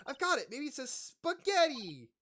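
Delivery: triumphant, stretch the word; eager guess. Acted. Maya guessing spaghetti, loud high triumphant voice.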